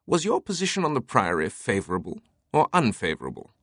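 lightly voiced character, pitch starting high and stepping down; Teabing. A narrator's reading. Teabing quizzing Langdon about the Priory, mildly amused. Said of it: "still chuckling".

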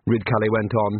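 ordinary delivery, nothing marked

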